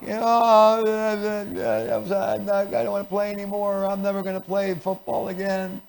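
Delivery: high, soft, drawn-out wail; acted crying child. Child hurt at football, mock tearful whining. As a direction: whine it through mock tears. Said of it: "They may be crying".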